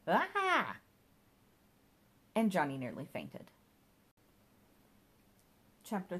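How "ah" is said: sudden high, loud cry of horror; cry of shock. A narrator's reading. Johnny recoiling from the dustbin, crying out in shock. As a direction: yelp out in fright. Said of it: "And Johnny cried".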